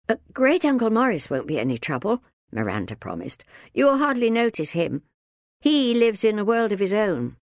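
put-on quick reassuring girl. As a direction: hurry to reassure.

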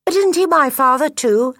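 put-on high, loud childlike voice; young gosling. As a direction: call out like an eager little gosling. Gosling questions his mother.

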